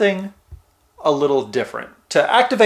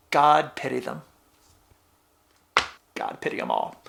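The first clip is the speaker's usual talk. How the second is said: pirate voice sinking to a low, hushed rasp; grave pirate, trailing into near silence; pirate accent; 3 semitones lower, 8 dB quieter than usual. Acted. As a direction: let the pirate's prayer fade away, very low. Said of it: "I might do this in a pirate accent".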